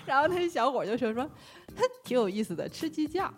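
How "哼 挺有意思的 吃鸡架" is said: mild scoffing snort, dismissive; mock the dish lightly; sneering tone. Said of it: "就是这种语气".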